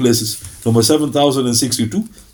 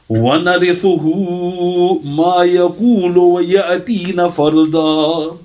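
brisk chanted Quran recitation, a little higher; 4 semitones higher, much faster than usual. Acted. Preacher recites the verse to the congregation, chanted. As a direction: intone the verse in Arabic recitation style.

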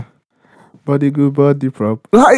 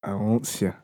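imitating Fleece Johnson; 5 semitones lower, 14 dB quieter, slower than usual. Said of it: "Fleece Johnson".

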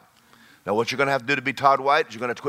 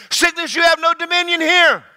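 much higher, loud rebuking shout; commanding shout; 12 semitones higher, 6 dB louder than usual. Shown as a demonstration. Parent rebuking a sick child's illness, high commanding shout. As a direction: command the sickness to leave. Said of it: "said it to your children that were sick".

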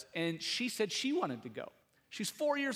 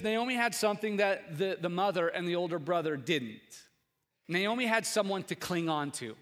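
ordinary delivery

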